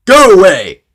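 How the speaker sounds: loud, high rude bellow. A narrator's reading. Mister Uppity dismissing the goblin, rude shout. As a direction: send them packing, very loudly. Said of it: "said Mister Uppity rudely".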